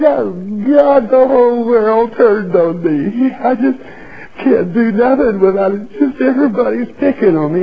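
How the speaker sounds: high, whining self-pity, mocking a complainer; mock the moaner who thinks everyone picks on him; whiny complainer. Preacher mocking a self-pitying churchgoer, high whiny voice. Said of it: "not be one of these".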